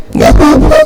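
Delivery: voiceless, choked-back little reply; mock stifled crying; imitated crying child. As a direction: play a tearful child denying it. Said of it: "Kau kok nangis?".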